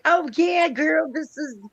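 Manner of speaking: put-on very high, gushing girlfriend; squealy-high excited quote; retold enthusiasm. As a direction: imitate an eager friend confirming it.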